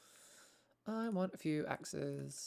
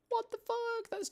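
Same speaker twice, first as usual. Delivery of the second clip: shocked; slower than usual. Real, not acted. Reacting to three languages taught.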